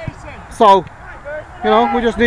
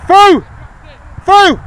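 higher, louder sideline shouting; yelling from the sidelines; 6 semitones higher, 4 dB louder than usual. Real, not acted. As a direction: holler across the field. Coach shouting instructions during play.

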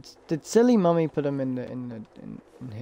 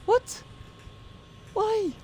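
startled squeak; 4 dB quieter than usual. Real, not acted. Startled after scare.

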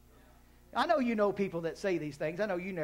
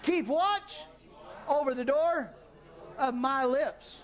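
congregation recital; 7 semitones higher, slower than usual. Shown as a demonstration. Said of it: "On the count of three".

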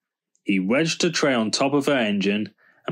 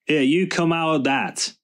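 higher-pitched, restrained storyteller's shout; mild shout; 6 semitones higher than usual. A narrator's reading. George catching Snoop in Belinda's engine, a mild shout. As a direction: call out sharply without much volume. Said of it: "he shouted".

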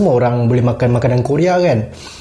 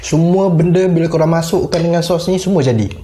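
chewing while talking; speaking around food; mouth full; 3 semitones higher than usual. Real, not acted. Seen 3: took a bite at -5; lips pursed, jaw chewing between words.

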